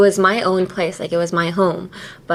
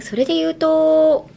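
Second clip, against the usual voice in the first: "それで言うと" pitched up and whispered; wrong rising tone; 8 semitones higher, faster than usual. Shown as a demonstration. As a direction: lift "それで言うと" high as a wrong example. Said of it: "you wouldn't be like".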